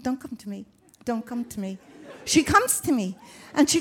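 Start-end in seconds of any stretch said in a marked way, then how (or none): 0.0-1.8 barely voiced inner plea, much softer; hushed plea. Thinking to herself as a swimmer approaches, hushed plea. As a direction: beg under your breath. Silently begging swimmer away.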